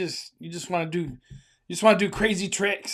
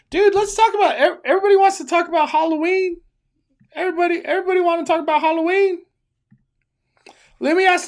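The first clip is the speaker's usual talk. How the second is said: high, fast, hyped-up chatter; excited; 8 semitones higher, 3 dB louder, faster than usual. Real, not acted. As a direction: fire it off, all worked up. Hyped streamer reacts to chat.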